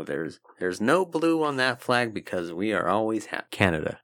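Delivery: put-on lightly done Canadian accent; a hint of Minnesota in a Canadian accent. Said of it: "just do a Canadian accent".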